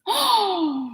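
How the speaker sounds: sharp surprised gasp; duck gasp. Acted. Reading the duck's part, startled gasp. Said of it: "And the duck gasps".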